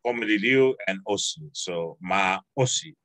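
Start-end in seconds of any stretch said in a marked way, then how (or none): 1.5-2.9 very slow "maosi" with the a stressed; careful slow maosi. Shown as a demonstration. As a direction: draw maosi out syllable by syllable. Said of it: "there's an emphasis on a".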